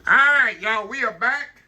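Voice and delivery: high, loud cheerful return; excited welcome back. Real, not acted. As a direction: burst back in with energy. Returning greeting after break.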